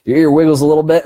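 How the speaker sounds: quick, loud, emphatic. Shown as a demonstration. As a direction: punch it out quickly. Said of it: "look at that".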